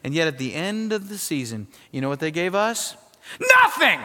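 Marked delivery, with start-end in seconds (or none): 3.4-4.0 shouted high and loud, punchline; emphatic shout. Real, not acted. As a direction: slam the answer out.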